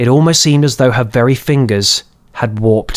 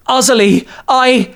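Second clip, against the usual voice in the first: voiced boy calling out; 12 semitones higher than usual. A narrator's reading. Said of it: "tried to call out one last time".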